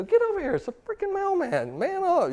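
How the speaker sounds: a dad telling his son to man up, firm and brisk. Retold or imitated. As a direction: tell him to toughen up, bluntly. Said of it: "I go".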